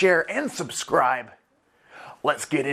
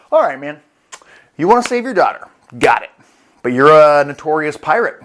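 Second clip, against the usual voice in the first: loud, punchy character voice; imitated deal-maker; 8 dB louder than usual. Saturn offering the pirate a deal, forceful. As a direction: lay out the terms forcefully.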